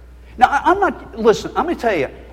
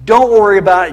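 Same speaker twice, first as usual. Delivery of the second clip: louder, pushed-up repeat of the reassurance; mock dismissive, louder insistence; 8 dB louder, faster than usual. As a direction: hammer it home, mockingly.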